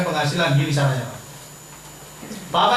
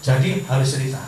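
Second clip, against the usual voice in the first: ordinary talk, nothing marked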